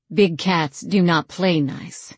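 plain reading voice

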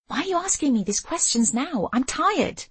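high-pitched exasperated complaint; exasperated, voice pitched well up; irritated outburst. A narrator's reading. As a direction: fire the question back, weary and cross. Irritated quarrel with husband in story.

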